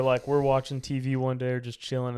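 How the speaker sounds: ordinary delivery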